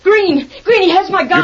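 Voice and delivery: high, urgent cry for help; alarmed call. Acted. Henchman's captive calls Green about the gun, alarmed call.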